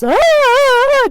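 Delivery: drawn-out shriek; long held high scream. Retold or imitated. She screams while dancing at the wheel. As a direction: hold the scream. Spider dance while driving.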